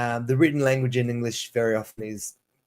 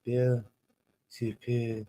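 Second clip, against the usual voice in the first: softer, tentative French-accented "super"; butchered French accent; 4 semitones lower, 8 dB quieter than usual. Shown as a demonstration. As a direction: feel out a French accent, lower and quieter. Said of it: "probably an utterly butchered French accent".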